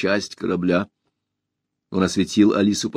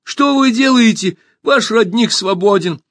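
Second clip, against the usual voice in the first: very high, loud cry; high-pitched indignant shout; shouting in protest; 12 semitones higher, 4 dB louder than usual. A narrator's reading. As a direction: call out urgently to the attackers. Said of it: "закричала Алиса".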